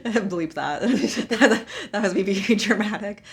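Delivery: talking through laughter; laughing. Real, not acted. A gamer laughs off her own dramatic outburst. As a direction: giggle the words out.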